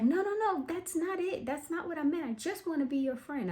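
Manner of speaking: high-pitched imitation of panicked protest; acted frantic denial. Voices Angela's frantic denial.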